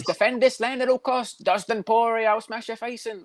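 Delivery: high-pitched mock fighter threat, slow at first; drawn-out high catchphrase impression; Dan Hooker. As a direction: mimic a New Zealand fighter's catchphrase up high. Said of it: "That's what he always says".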